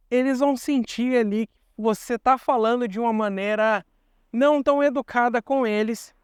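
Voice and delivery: ordinary delivery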